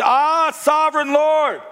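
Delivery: a stunned outcry, drawn out and high; awed exclamation. A narrator's reading. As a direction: call out in awe at the sight. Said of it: "he exclaimed".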